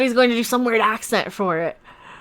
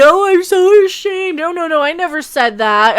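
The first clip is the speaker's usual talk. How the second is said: high, loud, hammed-up shame; exaggerated high-pitched mock apology; mock backpedalling; 6 semitones higher, 4 dB louder than usual. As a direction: imitate someone protesting in fake embarrassment. Acts out her imagined backpedalling.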